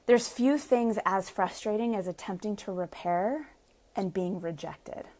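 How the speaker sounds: ordinary talk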